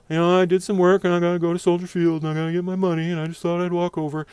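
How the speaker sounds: mock flustered babble to cops; nervous babble. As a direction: rush it like a nervous explanation.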